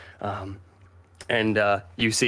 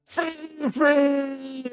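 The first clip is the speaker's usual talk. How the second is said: very high, drawn-out comic cry; wailing after Fifi. Acted. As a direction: squeal the name as a hammed-up farewell. Guardsman wails after departing Fifi.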